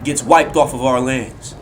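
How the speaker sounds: high, loud threat, peaking on the last cry; rally the army for slaughter; battle cry. Acted. Soldiers of Olympus threaten Spartans before battle, fierce war cry.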